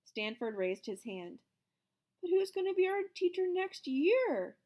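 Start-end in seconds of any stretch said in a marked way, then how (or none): 2.2-4.6 put-on high, loud kid's worried question; anxious boy asking the teacher; worried child. A narrator's reading. Student asking who will teach them, high and worried. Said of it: "he asked".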